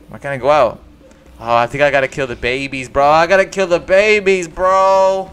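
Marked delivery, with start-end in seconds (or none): 3.1-5.3 higher, louder, slower hammed-up lament; mock anguish. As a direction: whine it like a tragic confession.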